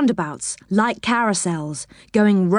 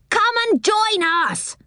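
high, slow sing-out call; 13 semitones higher than usual. A narrator's reading. Angry pixie calls passers-by into his party, calling out high. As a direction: call it over, drawn out and high. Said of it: "he called to Joe".

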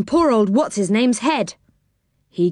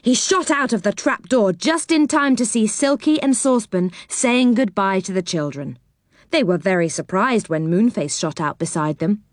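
ordinary delivery

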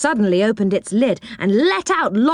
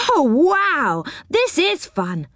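put-on delighted girl; 8 semitones higher, slower than usual. Beth delighted by toy land.

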